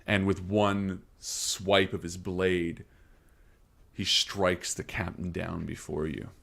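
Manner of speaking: slow narration sinking to a hush; game master scene setting. Acted. Game master describing the captain's beheading, hushed fading narration.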